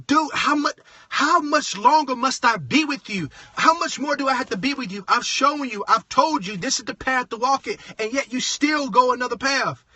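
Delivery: worked-up, frustrated mock-quote. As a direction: plead it like you're fed up with them. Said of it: "he was so frustrated. He was like".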